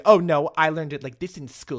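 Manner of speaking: loud mock protest; mock stubbornness. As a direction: play someone refusing a Spanish speaker's correction. Acted stubborn reply to Spanish speaker.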